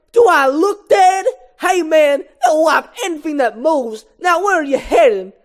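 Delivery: high, loud, incredulous comedian voice; Chris Rock. Acted. Mosquito Moose Blood sets Barry straight, loud high comedian voice. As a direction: holler it like a high-pitched stand-up comic. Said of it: "I'm gonna do the Chris Rock voice".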